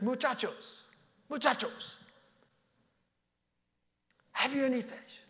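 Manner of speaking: high, far-off Spanish call. Acted. Jesus calling the disciples muchachos from the shore, calling out. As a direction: call out warmly to the lads. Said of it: "in Spanish, Jesus says".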